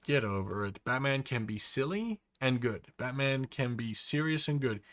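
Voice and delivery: normal talking voice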